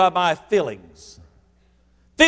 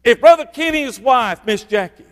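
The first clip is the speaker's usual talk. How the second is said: ordinary talk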